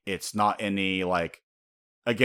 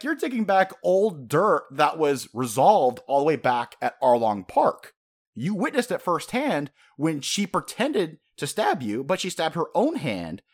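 high-pitched, worked-up indignant rant; exasperated scolding; 7 semitones higher, 4 dB louder than usual. Acted. A fan reminding Usopp about Arlong Park, exasperated. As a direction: lecture him in pained disbelief, voice pitched high.

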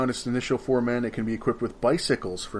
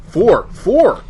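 excited counting; 10 semitones higher, 12 dB louder than usual. Real, not acted. Finding bonus units in rulebook.

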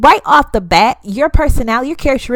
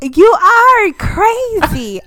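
playful disbelief; 8 semitones higher, much slower than usual. Real, not acted. Friend teasing in disbelief.

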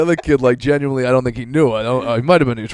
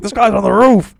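caller hammed up, higher and louder; mock drunk caller; 10 semitones higher, 9 dB louder than usual. Retelling a prank call to a friend, mock drunk caller voice loud and high. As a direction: play the drunk on the phone, pitched up and loud. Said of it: "they'd be like".

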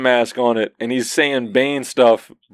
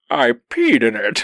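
Bane cat; 2 semitones lower than usual. Retold or imitated. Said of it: "and he's like".

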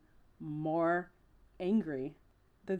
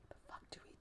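hushed, unvoiced and much softer, kids at a loss; panicked whisper; 25 dB quieter, much faster than usual. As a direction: whisper it in alarm. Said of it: "and they're like".

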